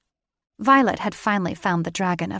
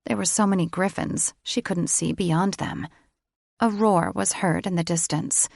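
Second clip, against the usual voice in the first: ordinary delivery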